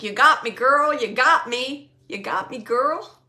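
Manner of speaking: imitating his loud, lilting call; loud, upswung cheer on each repeat; playful male spirit. Medium relaying a spirit's playful words, loud and lilting. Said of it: "He's saying it like that".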